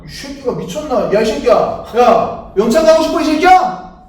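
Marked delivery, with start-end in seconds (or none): 1.1-4.1 angry yelling. Acted. Sergeant threatening brig.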